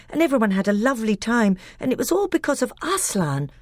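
put-on a child rushing through a tale; eager little boy. A narrator's reading. Caspian babbles to his uncle about the old kings, eager and boyish.

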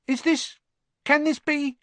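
high, breathless disbelief; gasping up in wonder; amazed barman. Acted. Old barman staring at famous Harry, high amazed.

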